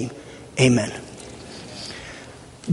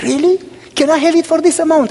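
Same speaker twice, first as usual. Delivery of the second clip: delighted surprise; 9 semitones higher than usual. Retold or imitated. Lady amazed by low price.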